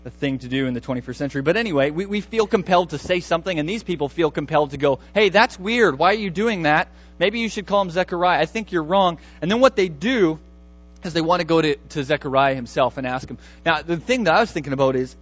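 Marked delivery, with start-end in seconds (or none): 5.1-9.2 raised, louder busybody voice questioning the name; mock-indignant neighbours scolding the choice; nosy objecting. Neighbours objecting to the baby's name, mock-indignant and louder.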